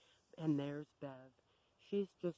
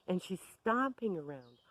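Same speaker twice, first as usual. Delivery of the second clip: usual speaking voice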